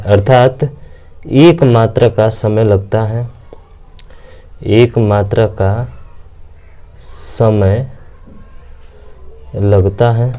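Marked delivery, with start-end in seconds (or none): 1.3-10.3 slow, spaced-out dictation pace; drawn out so students can write it down. Shown as a demonstration. Teacher dictating a definition to students, slow and spaced.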